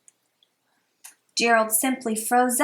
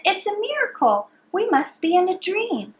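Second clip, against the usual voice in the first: higher, gasping wonder; amazed shout; 8 semitones higher than usual. A narrator's reading. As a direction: cheer in disbelief. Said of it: "They shouted".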